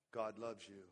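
low and soft, gently reassuring; soft reassurance. Retold or imitated. Pastor answering a troubled member, soft and reassuring. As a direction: tell them softly God loves them.

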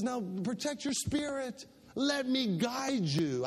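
high, impassioned preacher's voice; preaching. Acted. Pastor warning his people not to be like a mule, preaching. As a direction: plead with the flock, fervent and rising.

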